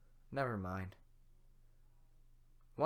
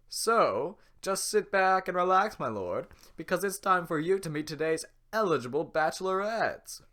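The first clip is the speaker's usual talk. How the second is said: talk show host; 4 semitones higher, 8 dB louder than usual. Acted. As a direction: hype the contestant like a host. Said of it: "sounds like a talk show host".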